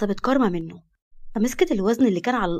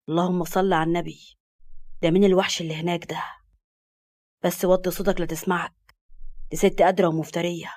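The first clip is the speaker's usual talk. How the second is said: lowered male voice, hushed warning; male friend; 5 semitones lower than usual. Acted.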